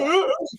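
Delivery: high-pitched mock "Ah!"; imitated reaction yell. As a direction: act out his reaction with a high cry. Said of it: "He'd be like".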